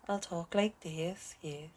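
slow, drawn-out stab at a Welsh accent; attempted Welsh accent. Shown as a demonstration. Showing listeners how they talk to someone from Wales, attempted Welsh accent. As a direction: have a shaky go at Welsh, dragging it out. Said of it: "if I'm talking to someone from Wales".

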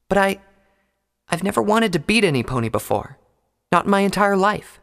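earnest unicorn, a little flustered; Twilight's voice. A narrator's reading.

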